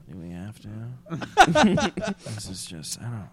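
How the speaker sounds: soft, low, moping clown sigh; mournful sad clown whine. Acted. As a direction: drag it out like a gloomy clown. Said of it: "sad clown".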